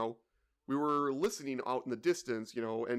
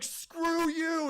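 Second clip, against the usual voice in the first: much higher and louder, shouted insult; mock furious yell; 14 semitones higher, 4 dB louder than usual. Driver cursing the other trucker, hammed-up furious yell. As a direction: hurl it like road rage.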